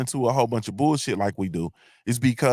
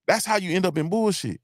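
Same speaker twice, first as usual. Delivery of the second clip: normal talking voice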